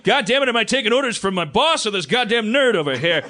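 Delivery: loud gruff exasperated bark; Keith David voice. Retold or imitated. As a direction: bellow it like a fed-up butcher. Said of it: "Keith David's the one that goes like".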